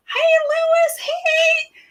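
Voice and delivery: put-on very high, little-kid hello; child's eager call. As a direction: call to a friend like an excited child. Six-year-old self calls to friend.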